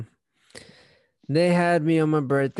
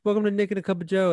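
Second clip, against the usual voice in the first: fast, higher show host intro; podcast host intro; 7 semitones higher, faster than usual. Pretend.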